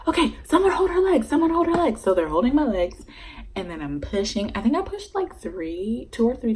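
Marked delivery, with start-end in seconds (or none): 0.1-2.0 much higher, fast bossy calling; brisk staff orders. Retold or imitated. Staff to each other in delivery room, brisk high orders. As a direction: bark it like a busy nurse. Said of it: "they're like".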